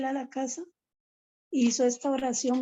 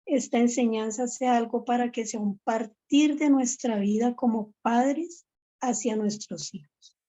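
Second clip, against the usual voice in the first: louder, fervent preaching exhortation; 5 dB louder than usual. Acted. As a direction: urge the congregation to commit, building with conviction.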